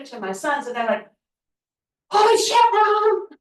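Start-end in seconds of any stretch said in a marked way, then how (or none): 2.1-3.3 much higher, louder, amazed; a son blurting out in disbelief; stunned exclamation. Retold or imitated. Sons seeing mom's photos with the athlete, amazed shout.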